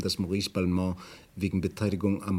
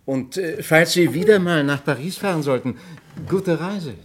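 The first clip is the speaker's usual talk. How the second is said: higher, louder, mock-friendly send-off; the detective's pointed goodbye, higher and louder; ironic farewell; 7 semitones higher, 8 dB louder than usual. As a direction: wish her a good trip with a barb.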